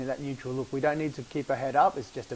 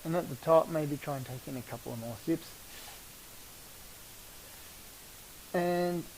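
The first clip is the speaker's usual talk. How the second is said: guided breathing; 7 dB quieter than usual. Acted.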